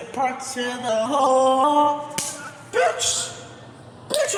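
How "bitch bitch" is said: higher shriek; mock shriek. Viewer hit by another video, shrieking. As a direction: squeal it at the screen.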